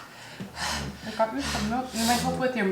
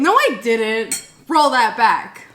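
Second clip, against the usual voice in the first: indignant denial; 3 semitones higher, 9 dB louder, slower than usual. Real, not acted. Denying accusation, playful argument.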